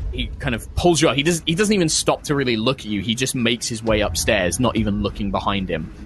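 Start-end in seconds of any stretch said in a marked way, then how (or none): none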